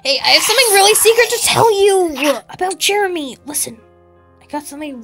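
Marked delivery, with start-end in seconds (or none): none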